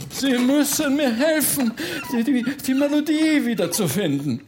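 high, urgent, stammering plea; pleading ghost baron. Acted. Ghost baron begs Bertha to find his melody, pleading. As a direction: beg desperately, pitch well up.

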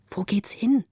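tonlos geflüsterte Frage; flüstere die Frage im dunklen Gang; ängstliches Flüstern. A narrator's reading. (bang geflüstert)

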